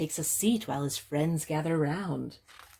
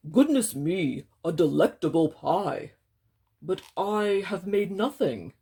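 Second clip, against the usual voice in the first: bear voice; 5 dB louder than usual. Acted. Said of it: "he adds with a sigh".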